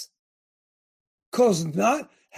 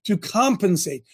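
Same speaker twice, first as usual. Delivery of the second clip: normal talking voice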